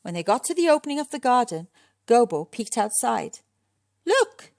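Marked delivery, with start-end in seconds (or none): 4.0-4.5 high, loud call; sudden high-pitched shout. A narrator's reading. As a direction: shout it out to get their attention. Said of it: "he shouted".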